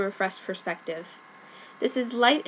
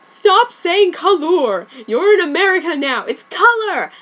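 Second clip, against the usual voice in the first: loud, higher hammed-up outburst; exasperated yelling; 9 semitones higher, 8 dB louder than usual. Light scolding Dark over his spelling, exasperated yell. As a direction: shout it in mock exasperation.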